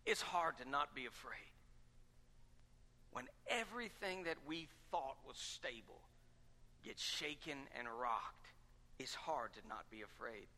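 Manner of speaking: hushed. Acted. Sermon drops to hushed intensity.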